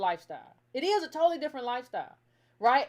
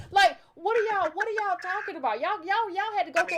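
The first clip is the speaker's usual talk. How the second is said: higher, louder what-are-you-talking-about disbelief; incredulous; 6 semitones higher, 4 dB louder than usual. Real, not acted. Host rails at fans who blame [name], incredulous and raised. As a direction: throw it at the critics in disbelief.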